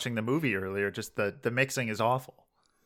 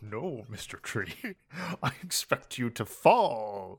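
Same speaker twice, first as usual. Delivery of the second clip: Bond villain parody; slower than usual. Pretend. Answers Connery Bond-villain parody.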